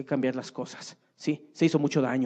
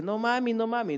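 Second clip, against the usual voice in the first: put-on child crying out; 7 semitones higher than usual. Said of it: "el pequeño le grita y le dice".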